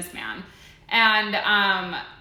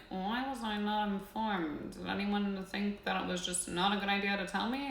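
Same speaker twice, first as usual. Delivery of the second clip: mock offended; 9 dB quieter than usual. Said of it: "I'm like".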